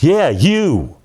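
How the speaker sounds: high-pitched mock anger; single someone out, imitating an angry boss; retold angry accusation. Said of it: "he's really mad".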